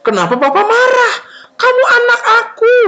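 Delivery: high, wailing fatherly reassurance; emotional protest. Acted. Father insists to his returned son, emotional exclaiming. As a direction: exclaim it with acted feeling.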